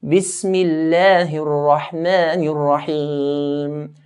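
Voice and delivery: Bismillahir Rahmanir Rahim slowly, crisp kasras; slow, clear kasras. Shown as a demonstration. Teacher recites the ayah slowly with clear kasras. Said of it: "concentrate on the kasra".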